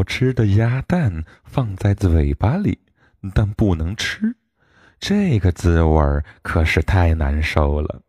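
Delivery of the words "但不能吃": usual speaking voice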